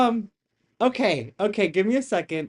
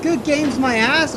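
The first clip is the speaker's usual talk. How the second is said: sarcastic; 7 semitones higher, 7 dB louder, slower than usual. Real, not acted. Sarcastic outburst at the stream.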